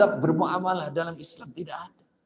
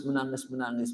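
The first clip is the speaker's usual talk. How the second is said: ordinary talk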